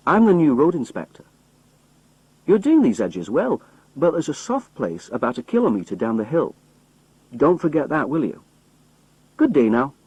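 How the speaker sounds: put-on a cheerful young road inspector giving orders; brisk young official giving the roadman his orders. A narrator's reading.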